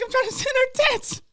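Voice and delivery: very high, loud mock wail; whining protest. Son complaining to mom about ruined fun, whining protest. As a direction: squeal the complaint, hammed up.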